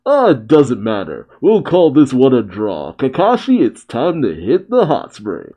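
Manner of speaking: ordinary talk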